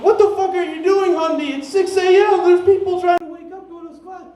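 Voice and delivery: high-pitched frantic scolding, retold; retold exasperated shout. Friend scolding a tripping buddy at six A M, retold exasperated shout. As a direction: yell as if re-enacting your own panic at a friend.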